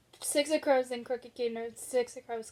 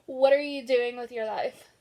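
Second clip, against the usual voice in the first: ordinary delivery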